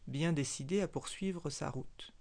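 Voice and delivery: usual speaking voice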